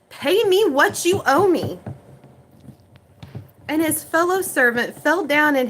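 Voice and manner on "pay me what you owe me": higher, louder, snarling demand; angry demand. Acted. Servant grabbing his debtor by the throat, angry shout. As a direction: threaten him for the money. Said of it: "took him by the throat and said".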